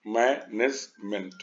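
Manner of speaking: Management in three short separate beats, मै, नेज, meant; split into syllables. Shown as a demonstration. Teacher recapping three syllables, each one separate. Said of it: "तीन में हो गया".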